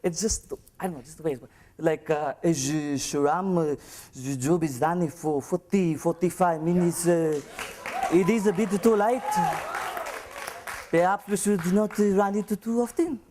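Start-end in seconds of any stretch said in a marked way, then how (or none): 2.4-13.2 put-on French accent. French colleague tells the speaker the job runs late, in French accent. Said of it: "a French colleague of mine".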